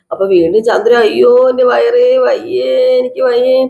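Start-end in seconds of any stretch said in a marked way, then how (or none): 1.1-3.6 slow, long-held moaning vowels; hammed-up bellyache cry, words dragged out; mock pain wail. Mother interrupting the others with her bellyache, drawn-out mock wail.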